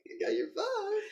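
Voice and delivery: high-pitched mock taunt; lightly hammed-up movie baddie; mocking villain. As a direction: tease it in a put-on high voice. Mimics a grinning film villain.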